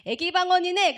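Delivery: high, loud dismissive exclamation; pastor's verdict. Retold or imitated. Pastor judges the student's baby tongues, exclaiming. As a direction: blurt it out as the startled pastor. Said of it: "그러시더니".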